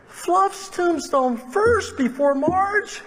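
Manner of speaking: very high and very slow, stretched in disbelief; astonished. Retold or imitated. Pastor asking Wayne about the dog's tombstone, astonished. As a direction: draw out every word in shock.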